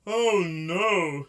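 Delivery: loud high sobbing "Oh no"; sob quality. Shown as a demonstration. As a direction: sing "Oh no" with an operatic sob. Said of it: "a sob quality".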